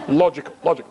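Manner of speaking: hint at a logical alien officer; Mister Spock. Acted. Rabbi pretends to be a Vulcan all weekend, lightly voiced. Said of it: "I was Mister Spock".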